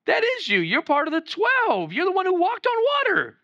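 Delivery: high-pitched, insistent mock girl; accusing young girl. Young girl recognizing Peter as a disciple, high accusing voice. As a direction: point him out in a high, excited voice.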